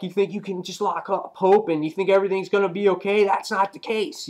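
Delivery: high, quickened agitated rant; freaking out. Retold or imitated. Retelling Cap confronting Wolverine over Hope, mock freak-out. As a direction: imitate a man losing his cool, pitched well up. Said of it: "he's freaking out".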